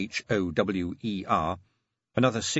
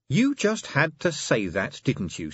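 exasperated; 6 semitones higher, slower than usual. A narrator's reading. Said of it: "said Angua".

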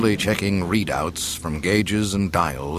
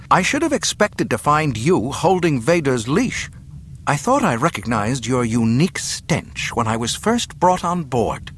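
higher-pitched scornful attack; spitting contempt; 6 semitones higher, 3 dB louder than usual. A narrator's reading. Captive princess insulting the governor, spitting scorn. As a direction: hurl the insult with open disdain. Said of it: "she spat".

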